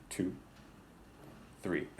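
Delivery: usual speaking voice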